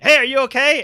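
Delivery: calling out. Acted. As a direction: shout the question to a friend who vanished. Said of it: "to ask Igneous is like".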